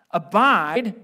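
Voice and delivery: ABIDE called out loud and rising; loud emphatic reading. Acted. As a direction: proclaim the word Abide full voice. Preacher reading scripture emphatically.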